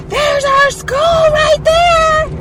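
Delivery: drawn-out, high-pitched holler; very loud yell. Retold or imitated. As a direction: yell it out at full volume, slowly. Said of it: "my voice is so loud".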